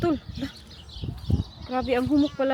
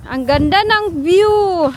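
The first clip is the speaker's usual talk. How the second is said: loud, drawn-out, higher exclamation; admiring exclamation; 4 semitones higher, 8 dB louder, much slower than usual. Real, not acted. Parent admiring the view on an outing, loud exclamation. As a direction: marvel out loud, stretching the words.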